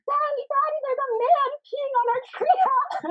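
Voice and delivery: put-on high, breathy little kid calling Daddy; child voice. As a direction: squeak it out like a scared small child. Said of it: "the kids are like".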